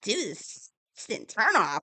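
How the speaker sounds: high, exasperated mock complaint; hammed-up whiny indignation; mock getting mad. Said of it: "he started getting mad".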